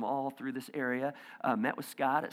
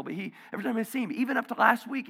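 ordinary delivery

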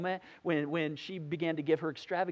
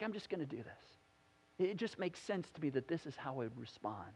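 quiet resolve; 8 semitones lower, 11 dB quieter than usual. Retold or imitated. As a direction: say it softly as if to yourself. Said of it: "she's like".

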